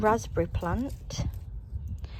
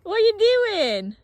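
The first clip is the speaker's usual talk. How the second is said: surprised; 13 semitones higher, 6 dB louder, slower than usual. Real, not acted. Surprised at partner's antics.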